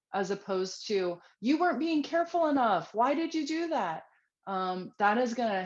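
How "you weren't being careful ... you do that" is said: higher, louder scolding; scolding parent. Shown as a demonstration. Parent scolding child over spilled milk, angry scolding. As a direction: blame the child sharply. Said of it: "not be mad or angry".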